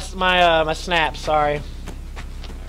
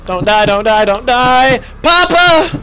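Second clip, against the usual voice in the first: rising, louder panicked cries; frantic pleading; 5 semitones higher, 4 dB louder, much slower than usual. Real, not acted. Player calling to Papa while fleeing, panicked cry. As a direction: beg for rescue as you flee.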